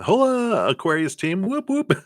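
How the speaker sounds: high, bubbly greeting to the Aquarius team; squealing hello to the fans; excited shout-out. Real, not acted. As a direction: cheer them on like a hype host. Greeting a new viewer group.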